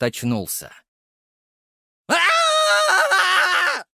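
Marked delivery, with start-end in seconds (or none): 2.1-3.8 high piercing scream of pain; agonized scream. Acted. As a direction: wail in sudden pain. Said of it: "заголосил он".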